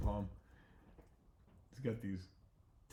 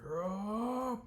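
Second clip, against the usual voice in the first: high, loud drawn-out roar; monster roar; 13 semitones higher, 5 dB louder than usual. Acted. The bear roars at Bodhi, loud and long. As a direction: roar like a big cartoon bear.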